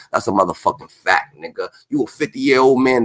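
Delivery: usual speaking voice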